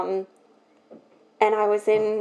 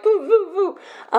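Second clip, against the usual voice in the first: giggly, high mock buzzing about; laughing boo; 10 semitones higher than usual. Describing her restless personality, a high laughing boo. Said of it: "I'm just sort of like".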